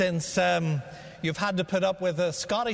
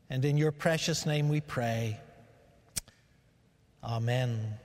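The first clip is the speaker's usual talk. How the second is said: prayer; 2 semitones lower than usual. Acted. Pastor leads a prayer.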